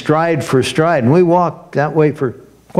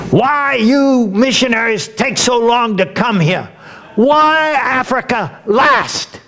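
indignant local man; 10 semitones higher than usual. Retold or imitated. Retold local man confronting him.

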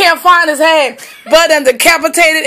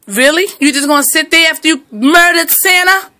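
ordinary talk, nothing marked

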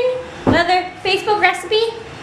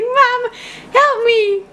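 lightly voiced pleading baby, higher pitch; voicing the baby; 6 semitones higher, slower than usual. Mother speaks for her stuck baby, lightly voiced high plea.

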